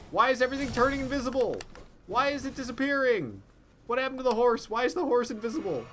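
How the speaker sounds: high, rapid confused questions; baffled. Real, not acted. Gamer baffled as his horse vanishes, high confused questions. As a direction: pitch way up, what on earth is going on.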